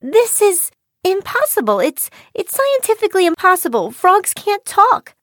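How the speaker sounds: high-pitched, flustered disbelief; high, incredulous outburst; calling out. A narrator's reading. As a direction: protest as an astonished girl. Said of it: "Chiyo called out".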